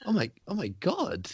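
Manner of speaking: soft high gasp, then halting wonder; hushed, hammed-up disbelief; mock astonishment. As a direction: imitate someone stunned by simple kindness. Mimics astonished checkout worker.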